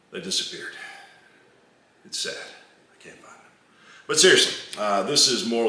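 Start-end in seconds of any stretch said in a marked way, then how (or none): 0.1-3.5 hushed, playful lament; mock sad. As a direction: fade away in pretend sorrow. Mock lament over missing co-hosts.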